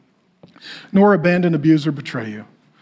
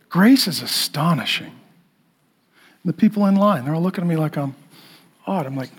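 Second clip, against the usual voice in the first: ordinary delivery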